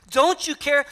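Martha's pained demand; frustrated plea. Retold or imitated. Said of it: "That is a plea to notice me".

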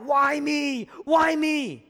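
crying out. Retold or imitated. Pastor voices Job crying out to God, pleading loud. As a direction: cry out to heaven as Job. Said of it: "Job cries out".

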